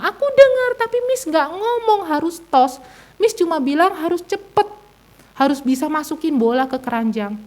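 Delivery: put-on very high child voice protesting; imitated tearful child. Child protests to mother about the lost game, imitated tearful whine. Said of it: "Dia masih nangis, gitu ya".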